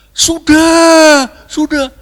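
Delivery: high, loud, stretched-out answer; crowd shouting back. Acted. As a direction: shout as a whole congregation in chorus. (seruan jemaat lantang dan panjang)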